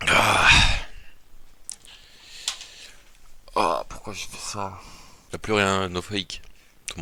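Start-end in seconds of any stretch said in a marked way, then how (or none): 0.1-4.8 nauseous, gagging between words; queasy and about to retch; dry heaving. Real, not acted. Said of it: "Je vais vomir".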